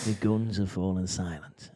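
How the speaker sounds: low, dramatic trailer voice; low dramatic announcement; dramatic announcer voice. Pretend. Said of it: "Johnny Hammers would make".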